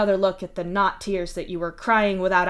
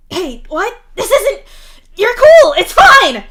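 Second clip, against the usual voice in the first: much higher, loud frantic insisting; insisting loudly; 13 semitones higher, 17 dB louder, slower than usual. Acted. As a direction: drown out the panic, it's fine. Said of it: "Mituna insists loudly".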